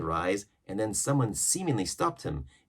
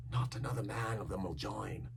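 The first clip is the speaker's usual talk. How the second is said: quiet; 7 dB quieter than usual. A narrator's reading. Overheard quiet line.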